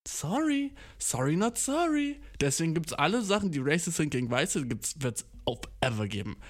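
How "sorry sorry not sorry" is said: mock apology. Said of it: "mock apology".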